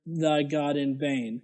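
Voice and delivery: low, slow, quiet scripture reading; hushed mock-holy recitation; mock solemn scripture reading. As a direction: intone it low like a solemn preacher. Reading the commandments aloud.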